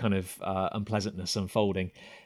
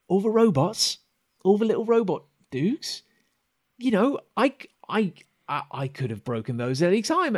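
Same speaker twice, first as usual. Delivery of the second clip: high, halting character voice; pleading buddy; 11 semitones higher, 6 dB louder than usual. Acted. Okus poking his buddy and insisting they are mates, high and defensive. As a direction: plead friendship, voice pitched up.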